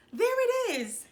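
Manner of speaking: high, loud excited stork voice; stork finds the train. A narrator's reading. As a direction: squawk it out like a bird spotting its goal. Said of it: "he said".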